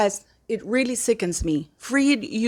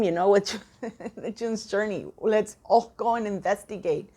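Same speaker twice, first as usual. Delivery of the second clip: ordinary talk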